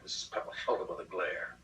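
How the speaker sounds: plain reading voice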